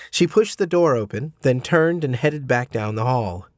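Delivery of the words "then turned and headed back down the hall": usual speaking voice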